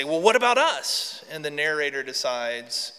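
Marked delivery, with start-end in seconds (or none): 0.0-1.2 high, loud chorus of complaint; raised, squeaky protest; characters chiming in. Acted. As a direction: pipe up like put-out little storybook animals. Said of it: "begin to chime in and they say".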